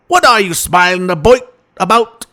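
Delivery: a lilting storytelling dad; Bill Cosby impression. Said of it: "That's when Bill got pissed".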